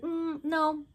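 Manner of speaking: high dismissive hum, then no; airy refusal. Retold or imitated. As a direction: brush it off. Mika refusing.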